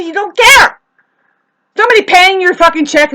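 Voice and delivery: loud, high furious rant; angry yelling. Acted. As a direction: yell at the waiter. Acted rant at a server.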